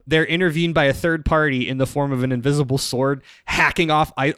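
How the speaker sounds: normal talking voice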